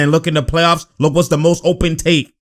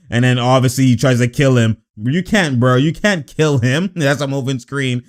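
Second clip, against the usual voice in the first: normal talking voice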